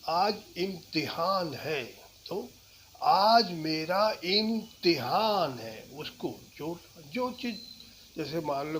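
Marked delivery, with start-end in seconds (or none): 3.0-5.8 आज hit hard, slow and louder; stress on आज. Shown as a demonstration. Teacher demoing stress on आज. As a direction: land on आज. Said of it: "किसी शब्द पे जोर देना है".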